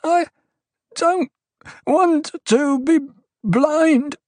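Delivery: high, slow whimpering plea; cowering plea. A narrator's reading. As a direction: beg in a frightened whimper. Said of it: "someone whimpered".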